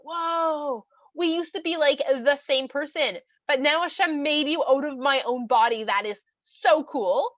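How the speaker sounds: wowed, drawn-out, loud delight; amazed. Acted. Newly woken man marvels at the woman, amazed. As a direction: voice someone wide-eyed with amazement, stretching key words.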